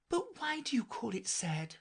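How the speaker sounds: put-on high girl's questioning voice; puzzled Alice. A narrator's reading. Alice questioning the mouse about its tale, high and puzzled.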